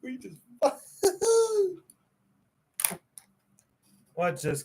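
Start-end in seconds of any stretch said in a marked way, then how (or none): 1.0-1.7 high giggle. Real, not acted. Laughing mid-sentence.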